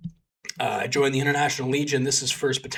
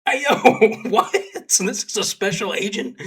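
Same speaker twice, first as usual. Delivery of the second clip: amused disbelief; 8 semitones higher, 6 dB louder than usual. Retold or imitated. Reacting to agent's yell.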